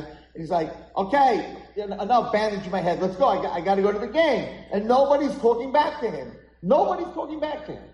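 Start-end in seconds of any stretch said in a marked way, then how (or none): 1.0-4.5 raised, louder acted protest; impatient boy. Boy argues with medics. 4.7-7.9 high, loud emphatic narration; exasperated telling. A narrator's reading. Teller stresses boy being ignored.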